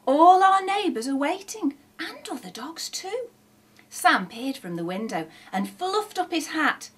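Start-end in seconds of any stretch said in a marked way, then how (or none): 0.1-1.8 high, loud, thrilled marvelling; grinning amazement. A narrator's reading. Shifty points out the waiting neighbours, thrilled. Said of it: "grinned Shifty". 2.0-3.3 high, softer tail of wonder; grinning amazement. A narrator's reading. Shifty spots more customers in the queue, delighted. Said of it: "grinned Shifty".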